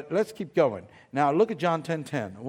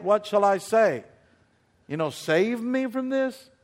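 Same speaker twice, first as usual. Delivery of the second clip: rising preacher's voice, louder; preaching; 3 semitones higher, 4 dB louder than usual. Acted. Preacher assuring the church God stays near, preaching. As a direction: preach it to the congregation.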